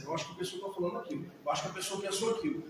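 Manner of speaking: soft, fast, run-together rumination; quick anxious muttering. Shown as a demonstration. Therapist mimics an overthinking mind, soft and fast. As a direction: rattle off the worried thoughts under your breath. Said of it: "Aí se eu fizer assim".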